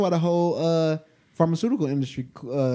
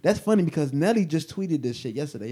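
usual speaking voice

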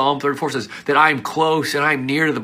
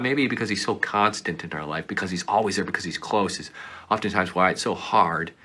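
ordinary talk, nothing marked

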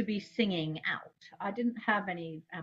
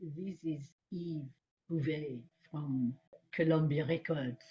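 put-on measured French accent, pitched down; 4 semitones lower, 4 dB quieter than usual. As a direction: imitate a Frenchman leaving a formal message. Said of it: "this guy with a French accent".

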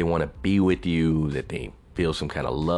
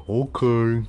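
drawn-out mock "okay", higher and louder; mock reply; 5 semitones higher, 5 dB louder than usual. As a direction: imitate an annoying reply, pitched up. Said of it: "the bitch replies back with".

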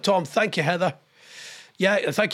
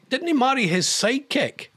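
ordinary talk

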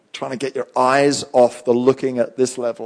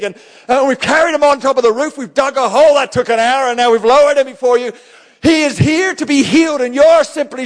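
high, loud, exasperated yell of tired friends; the four guys freaking out; 11 semitones higher, 4 dB louder than usual. Retold or imitated. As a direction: shout it in disbelief, as if you carried him for kilometres. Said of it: "They're going".

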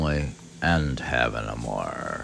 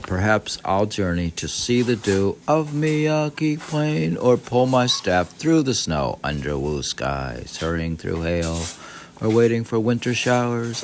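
higher, lilting reading of the journey passage; host reading Basho's wanderings, rising higher at the end; poetic reading; 7 semitones higher, 3 dB louder than usual. Acted. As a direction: read it as a wistful travel poem. Reads Basho text aloud.

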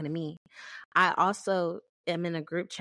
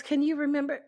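mock older person; 8 semitones higher than usual. Mocking an older person asking her to remember, pitched up. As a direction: ask it like a nagging older lady. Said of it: "someone my age older says".

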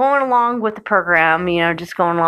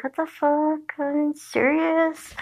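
high, soft, drawn-out mock disbelief; incredulous amusement; 8 semitones higher, 7 dB quieter, slower than usual. Recalling laughing at the surprise scene, incredulous. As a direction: squeal it softly in amused disbelief. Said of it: "I was laughing in the beginning".

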